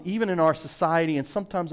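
normal talking voice

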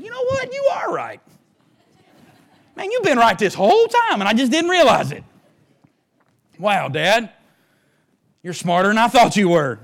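high, lightly voiced younger self, marvelling; a hint of a humbled son admitting Dad was right; humbled son telling Dad he was right all along, mock-earnest and high. Retelling his talk to Dad.

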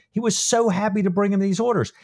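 plain reading voice

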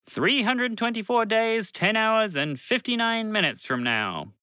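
slow, high, exact reading of the time; precise machine countdown. Acted. A lightly voiced machine. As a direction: spaceship reports the time to Bobby, slow precise robot voice.

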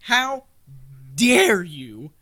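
loud, high, slow outraged yell; furious outburst. Acted. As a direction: explode in offended fury. Offended centaur rages.

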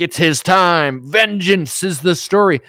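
loud, slow, hammed-up trailer announcer; mock-epic proclamation, stretched and loud; movie trailer voice. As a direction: boom it out high and drawn-out like a blockbuster trailer. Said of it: "Guys, it's like a movie trailer".

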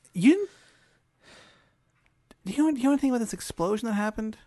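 high and fast, awkward rush; the awkward prince asking the player about the explosion, high and rushed; nervous Prince of Vegetables. Acted. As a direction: blurt the question out in a jittery squeak.

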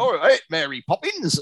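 high-pitched bad British accent; hammed-up American attempt at British. Co-host mimicking a film actor, bad British accent.

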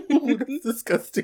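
high, loud, drawn-out revulsion; real amused disgust. Real, not acted. Friend reacting to a creepy suitor story, disgusted. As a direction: recoil loudly, stretching it out high.

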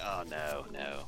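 low, soft, deflated "oh no"; mock dismay, sinking low and quiet; put-off reaction. British host retelling his turn-off at American accents, put-off and subdued.

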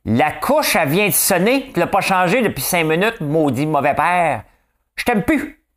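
high, loud scolding rant; angry spouse. Pretend. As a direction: scold the bad father, rising to shrieking. Acted phone quarrel, angry parent.

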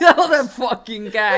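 loud, slow, laughing adoration. Real, not acted. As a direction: gush through laughter.

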